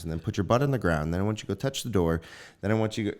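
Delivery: fast dad reeling off a list of tasks; rapid-fire instructions. Acted. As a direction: rattle off orders without a break. Dad rattles off distracting instructions.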